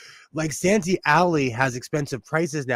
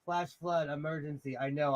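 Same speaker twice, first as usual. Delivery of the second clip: ordinary talk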